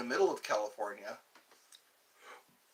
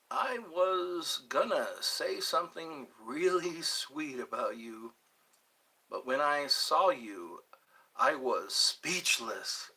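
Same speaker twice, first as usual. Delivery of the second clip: slow pickup line; slower than usual. Pretend.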